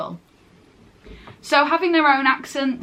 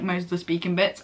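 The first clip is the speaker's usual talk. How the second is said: ordinary delivery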